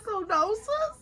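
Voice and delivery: high, squeaky, unconvincing no; feigned denial. Retold or imitated. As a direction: deny it in a very high voice, badly covering. Feigned denial to calm a friend.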